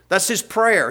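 ordinary delivery

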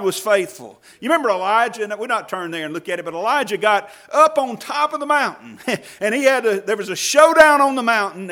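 loud, driving sermon climb; fiery pulpit preaching; preaching at full force. Acted. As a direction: preach it hard to the congregation.

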